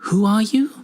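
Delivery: high-pitched, startled demand; alarmed junior researcher. Acted. Researcher confronts badgeless stranger, alarmed and high. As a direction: demand it, voice jumping up.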